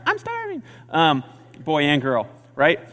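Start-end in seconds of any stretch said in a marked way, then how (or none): 0.0-0.6 put-on very high squeak of a tiny child; squeaky little kid. Little kid squeaking to parents from the back seat.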